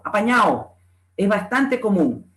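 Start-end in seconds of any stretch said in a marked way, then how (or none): 0.0-0.7 APAÑADO ending in -AO; dropped D. Shown as a demonstration. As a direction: leave the D out of "apañado". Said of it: "La pérdida de la D intervocálica".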